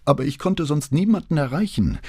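high plaintive self-defence; pleading young man's whine; whining. A narrator's reading. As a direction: whine the excuse at a high pitch. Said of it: "greinte der junge Mann".

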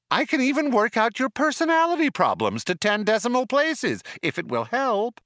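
high gushing computer; cheery computer voice. A narrator's reading. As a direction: keep the chirpy machine glee.

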